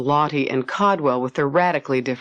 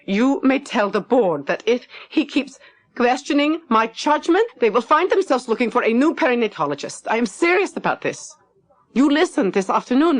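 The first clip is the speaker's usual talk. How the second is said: much higher, firm threatening ultimatum; accusing Lottie; 9 semitones higher than usual. Acted. Lottie warning Max to tell the board, high and furious. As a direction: lay down the ultimatum, finger pointed.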